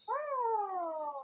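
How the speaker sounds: drawn-out ghostly ooh; long ghostly boo. Pretend. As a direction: hold a long spooky moan. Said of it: "Do the long one".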